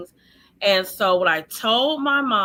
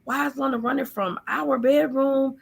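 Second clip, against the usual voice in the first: mildly imitating an upset mother, higher pitch; mock demanding questions, pitched up; retold going off; 6 semitones higher than usual. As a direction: raise the pitch, retelling her indignant questioning. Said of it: "like, going off".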